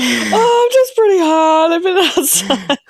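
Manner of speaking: high, embarrassed excuse; high, sheepish self-mockery; flustered self. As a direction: bluster through an awkward excuse. Quotes her own awkward reply.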